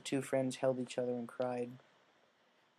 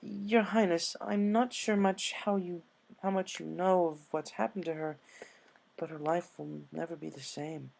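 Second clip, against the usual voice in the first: higher voiced elegant unicorn pleading; earnest Rarity; 5 semitones higher than usual. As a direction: plead sincerely to royalty.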